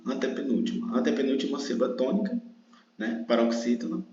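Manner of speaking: normal talking voice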